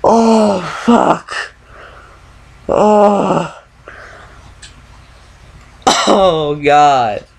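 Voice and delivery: screaming in terror; being chased. Real, not acted. Caught by monster, screaming.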